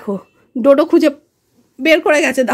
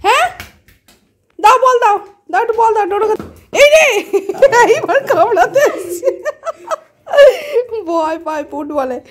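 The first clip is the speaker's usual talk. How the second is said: high, sing-song talk to a pet; 12 semitones higher, 3 dB louder than usual. Real, not acted. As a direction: coax the pet in a high playful voice. Calling pets playfully. (উঁচু গলায় পোষ্যের সঙ্গে আদুরে কথা)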